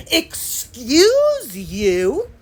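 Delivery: very slow, dragged-out, outraged "Excuse you?"; exaggerated indignant excuse you. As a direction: drag every sound out in disbelief. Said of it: "Then I have to do that".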